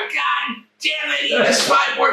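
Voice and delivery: high furious yell, slowed; angry shout. Retold or imitated. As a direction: bellow it like an enraged boss. Angry promoter Vern cursing.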